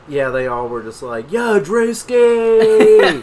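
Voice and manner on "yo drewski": loud slow drawn-out cheer; cheering shout. Retold or imitated. Students hype their teacher after his song, loud cheer. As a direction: stretch it out like a crowd hyping someone.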